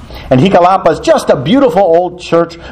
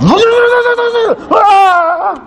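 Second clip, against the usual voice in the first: high imitated chainsaw whir breaking into a scream. Priest seeing man with a chainsaw, terrified shriek. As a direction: buzz like a chainsaw, then shriek in mock terror.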